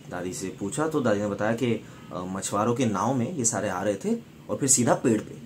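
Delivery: usual speaking voice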